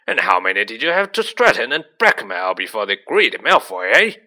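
loud, angry gruff giant; furious bellowed challenge; roaring. A narrator's reading. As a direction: roar the accusation at full volume. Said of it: "he roared".

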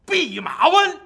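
a high, loud cry of alarm; shouting in alarm. Acted. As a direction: yell the name in terror. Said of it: "大叫一声啊".

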